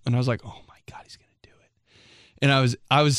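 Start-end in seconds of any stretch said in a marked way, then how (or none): 0.5-1.7 hushed, panicked whisper. Retold or imitated. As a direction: whisper it like you're about to be caught. Panicked whisper about getting caught.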